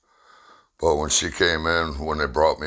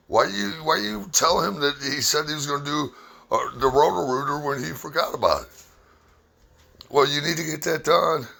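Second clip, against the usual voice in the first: bickering couple; 11 semitones higher than usual. Retold or imitated. Wife and husband bickering quoted.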